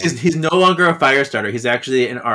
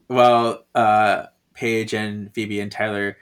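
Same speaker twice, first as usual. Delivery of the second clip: usual speaking voice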